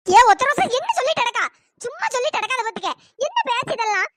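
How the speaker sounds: loud shocked cry, then a quick scold; disbelieving shout. Acted. (அதிர்ச்சியில் உரக்கக் கத்துவது)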